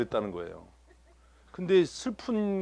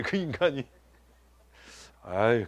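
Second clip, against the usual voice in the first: usual speaking voice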